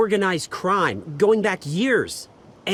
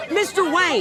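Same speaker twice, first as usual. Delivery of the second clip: calling out; 9 semitones higher than usual. Acted. Reporter calling over crowd.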